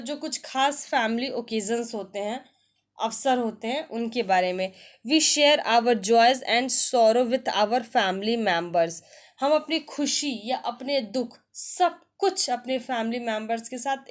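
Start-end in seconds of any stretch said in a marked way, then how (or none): 5.1-8.9 slow, careful English read-aloud; slow textbook reading. Shown as a demonstration. Teacher reading the family lesson to students, slow and deliberate. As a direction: spell it out slowly for learners.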